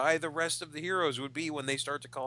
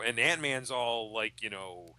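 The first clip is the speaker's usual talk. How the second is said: ordinary talk, nothing marked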